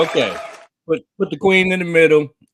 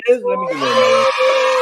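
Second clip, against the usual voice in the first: high stretched call; 4 dB louder, slower than usual. Real, not acted. High shout while fixing boxes.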